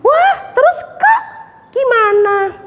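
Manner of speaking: put-on high, excited child voice; eager puppet girl. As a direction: beg to hear what happens next.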